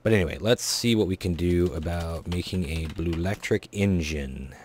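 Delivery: usual speaking voice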